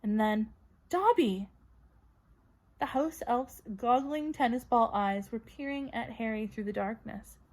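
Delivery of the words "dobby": high, loud startled call; startled cry. A narrator's reading. Harry waking to find Dobby, startled cry. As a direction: jolt at the name.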